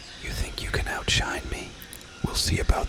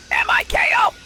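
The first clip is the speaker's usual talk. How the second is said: much higher and louder, letters punched; spelled-out shout; 8 dB louder than usual. Acted. Shouting her name from the balcony, loud. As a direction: yell each letter of the name. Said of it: "really emphasize those syllables".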